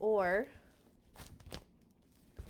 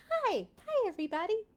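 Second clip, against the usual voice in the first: put-on much higher, sugary little-girl voice; Shirley Temple; 13 semitones higher, faster than usual. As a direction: chirp it like a tiny child star. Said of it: "Shirley Temple".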